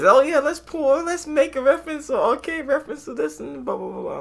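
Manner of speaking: high-pitched singsong mockery, fading at the end; mocking imitation. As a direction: mimic gushing filmmakers in a silly high voice. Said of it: "it's always".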